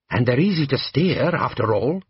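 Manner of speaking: ordinary delivery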